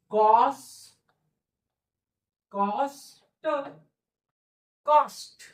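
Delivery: कॉस- then कॉस्ट, adding the final st; teacher blending कॉस्ट; building up cost. Shown as a demonstration. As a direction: sound out कॉस्ट step by step. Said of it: "कॉस्ट म्हणजे काय?".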